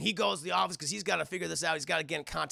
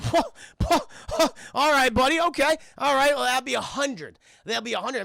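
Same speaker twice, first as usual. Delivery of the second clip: startled; 6 semitones higher, 7 dB louder than usual. Retold or imitated. Dealers startled by big order.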